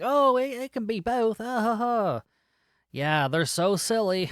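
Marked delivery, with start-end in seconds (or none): 0.0-2.2 high-pitched mock British with put-on laughter; hammed-up British voice, pitched way up; British accent. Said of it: "trickling in some, uh, British accents, uh, here and there".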